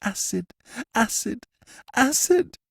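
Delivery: high, terrified gasps building to a cry; panicked prisoner, rising to a shriek; gasped in terror. A narrator's reading. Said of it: "gasped the prisoner".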